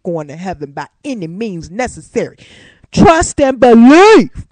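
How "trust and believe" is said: loud and slow, each phrase hammered; emphatic testifying. Real, not acted. Testifying to viewers she will reach paradise, loud and slow. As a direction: declare it like a church testimony.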